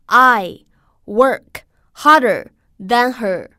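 word by word, loud, with pauses between; slow model reading. Shown as a demonstration. Teacher models the sentence for students, slow word by word. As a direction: read it out slowly and firmly for learners to copy. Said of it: "我们来读一下这个句子".

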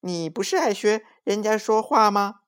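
high, cheeky monkey voice. A narrator's reading. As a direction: tease like a monkey. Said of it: "小猴子抓抓耳朵说".

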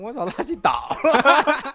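retold shock. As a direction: relive the moment he saw him down. Discovering friend collapsed, retold. (边笑边惊呼)

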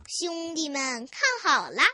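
usual speaking voice

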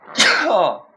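sharp, loud real cough; one loud cough. Real, not acted. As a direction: clear the throat with a hard cough. Loud cough mid-story.

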